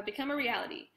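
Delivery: ordinary delivery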